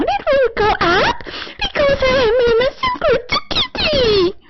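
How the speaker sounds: squeaky high falsetto; cutesy high kitty voice; going up. Pretend. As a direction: pitch it way up, innocent kitty. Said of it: "my voice will not go down".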